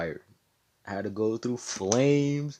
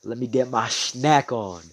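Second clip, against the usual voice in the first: gloating villain; 3 semitones higher, 9 dB louder, slower than usual. Acted. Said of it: "Ladros is like".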